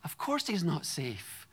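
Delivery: usual speaking voice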